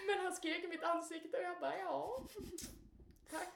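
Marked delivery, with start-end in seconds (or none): 1.8-3.5 small, high, soft voice; meek reply. Retold or imitated. Woman thanking the man who screamed in her face, meekly. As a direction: answer faintly, with a pause.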